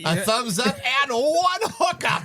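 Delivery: high, slow howling shout; basset hound. Pretend. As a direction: howl it like a hound on a porch. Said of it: "you sound like a freaking hound dog laying on the porch".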